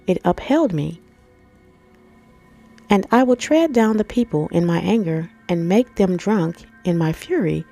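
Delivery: usual speaking voice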